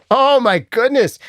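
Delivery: a stunned laugh at the idea. Real, not acted. Reacting to a viewer's dystopian AI copyright idea, laughing.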